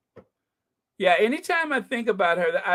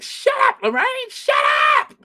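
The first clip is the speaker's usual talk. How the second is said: exasperated shout; 7 dB louder than usual. Retold or imitated. Viewer yelling at Lorraine to let the guest talk. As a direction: shriek it at the screen. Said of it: "I'm like".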